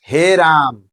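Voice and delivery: हे राम with both vowels drawn out; extra-long vowel. Shown as a demonstration. Teacher demonstrating the pluta vowel in an address, drawn-out हे राम. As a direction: stretch हे and राम like a long invocation. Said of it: "दीर्घ स्वर से भी अधिक समय लगता है".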